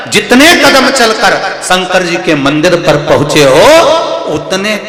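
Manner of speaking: high, loud preaching with rising passion; ringing katha sermon voice; fervent preaching. Acted. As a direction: lift the crowd with devotion. Preacher at full force.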